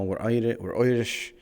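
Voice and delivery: put-on broad stage-Irish accent, Irish rolled into Oirish; hammed-up Irish accent. Streamer introducing his Irish character Sean O'Delly, hammy Irish accent. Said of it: "We're using our voice now".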